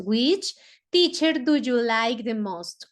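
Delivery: slow, careful English reading; English model sentence. Shown as a demonstration. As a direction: read it slowly for students to copy. (pregunta en inglés, lenta y clara)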